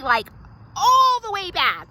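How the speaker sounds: normal talking voice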